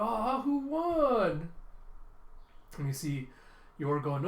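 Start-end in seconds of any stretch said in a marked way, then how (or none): 0.0-1.5 put-on very slow, high child voice; long, swooping childlike question; Anya. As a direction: draw it out high and wondering. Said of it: "you have Anya be like".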